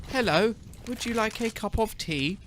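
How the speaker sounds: high, put-on English voice asking about tea; hammed-up British for a chat who said he doesn't sound British; posh British accent. Mock British accent for the viewers. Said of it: "Is that more British?".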